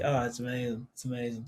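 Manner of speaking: low, soft Scouse imitation; imitated Liverpool friend. Guest showing how the friend sounds, Liverpool accent. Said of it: "And she sounds like".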